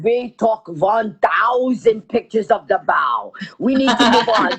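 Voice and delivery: put-on slow, heavy Russian accent. Russian pilot wanting to leave the wreck, heavy accent. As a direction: imitate a fed-up Russian pilot. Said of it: "And the Russian guy is going".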